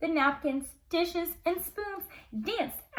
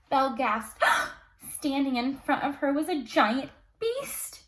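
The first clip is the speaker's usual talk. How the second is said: ordinary delivery, nothing marked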